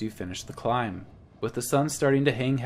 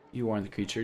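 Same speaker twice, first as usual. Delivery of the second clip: usual speaking voice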